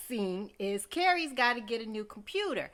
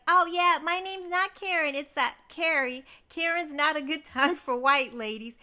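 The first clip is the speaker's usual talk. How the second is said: chirpy planner; 5 semitones higher, 6 dB louder than usual. Retold or imitated. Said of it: "the lady, she's like".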